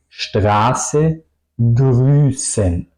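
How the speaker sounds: long vowel and long S in "Straße, Grüßen"; vocal y ese largas. Shown as a demonstration. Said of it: "la vocal larga y la S larga". ("Straße, Grüßen" con vocal larga y ese larga)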